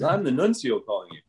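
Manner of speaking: put-on lightly feigned Italian accent; mock-formal phone caller with a mild Italian accent. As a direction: imitate an Italian official on the line. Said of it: "feigns an Italian accent".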